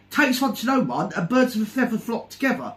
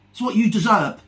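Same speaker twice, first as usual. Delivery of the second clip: usual speaking voice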